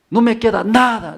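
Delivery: high, loud mock seller's pitch; market seller's claim. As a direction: hawk it like a lying vendor. Vendedor del mercado que miente. (vendedor exagerado)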